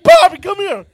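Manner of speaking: high, loud urgent call. Retold or imitated. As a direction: call for help across the house. Girlfriend screaming for Bobby.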